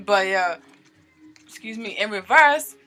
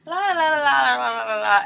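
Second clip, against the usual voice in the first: high, loud run of la-la-la sliding down; gleeful rapid la-la sound effect; excited la-la-la; 6 semitones higher, 4 dB louder than usual. Retold or imitated. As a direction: babble la-la-la like cards flying in a shuffle. Mimics excitement of shuffling cards.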